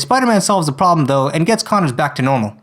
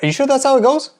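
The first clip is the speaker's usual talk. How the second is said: high-pitched puzzled heckler voice; a higher second voice cutting in, confused; baffled heckler; 8 semitones higher than usual. Acted. Off-screen voice heckling the singer, high and puzzled.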